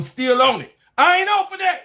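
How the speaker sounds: rising into a loud high squawk; high-pitched ranting yell; worked-up insistence. Real, not acted. Rant escalates about the firing.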